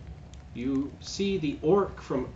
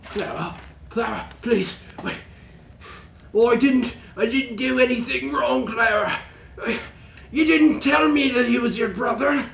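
high, loud desperate pleading; begging orc; 12 semitones higher, 8 dB louder than usual. Acted. Chained orc begs Clara for mercy, high frantic shouting. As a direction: beg for your life, panicking. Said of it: "He's like".

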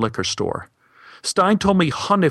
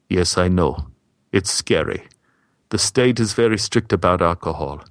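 lower, grave warning; a hint of Stein sensing danger, lower; ominous; 5 semitones lower than usual. Stein warns the American about the liquor store, low and grave. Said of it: "spoke as if he knew there was danger ahead".

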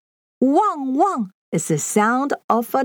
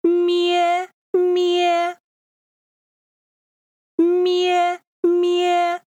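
sheep bleat; 7 semitones higher, much slower than usual. Shown as a demonstration. Sheep sound guessing game.

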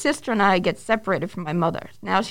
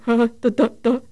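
stuttering. Retold or imitated. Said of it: "stuttering like an idiot".

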